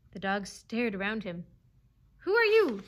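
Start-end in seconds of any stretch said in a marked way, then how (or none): 2.2-2.8 high, loud demand; yelped challenge. A narrator's reading. Dog challenging the jeering animals, yelping. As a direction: yelp like a cornered dog. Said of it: "he yelped".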